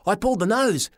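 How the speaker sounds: usual speaking voice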